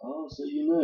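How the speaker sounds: a sharp, high gasp of surprise; startled. Acted. Client startled that Spade knows about them, voice leaps up high. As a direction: voice jumps up high in alarm.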